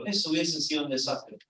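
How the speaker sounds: quiet echo. Real, not acted. Preacher repeating the lesson to the congregation, softly.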